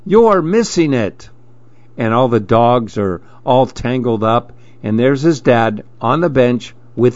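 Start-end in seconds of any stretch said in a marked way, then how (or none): 0.1-1.3 high, singled-out call to a distracted dad; high-pitched kid's plea; boy calling out. A narrator's reading. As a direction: urge like an impatient child.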